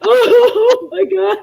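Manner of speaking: real laughter tears winding down; weepy laughing sorry; crying from laughing. Real, not acted. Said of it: "I'm crying".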